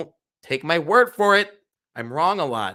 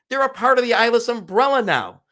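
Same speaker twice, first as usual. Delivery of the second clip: higher and louder, insisting; emphatic; 9 semitones higher, 6 dB louder than usual. Real, not acted. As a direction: push the point hard. Insisting to complaining shareholders.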